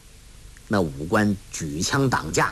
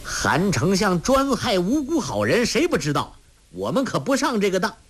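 higher, fast, scornful; indignant call; 8 semitones higher than usual. Acted. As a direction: refuse the trick with contempt. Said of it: "杨铁心叫道".

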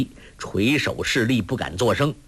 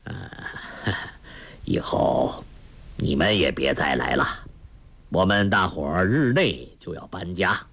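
put-on very low-pitched old master, chuckling first; kindly elder's low voice; smiling elder; 7 semitones lower than usual. A narrator's reading. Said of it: "一灯微笑道".

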